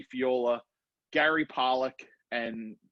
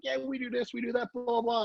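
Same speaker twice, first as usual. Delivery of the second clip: breezy pro chatter; 7 semitones higher than usual. Retold or imitated. Pros chatting after the demo, light mock voice. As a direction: lightly imitate the pros' easy chatter.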